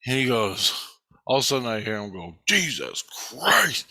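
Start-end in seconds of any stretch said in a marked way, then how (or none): 2.5-3.8 slow, drawn-out stunned "Jesus Christ"; imitating a friend's stunned gasp of words; shocked exclamation. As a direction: let it out slowly, stunned. Friend's shocked find retold.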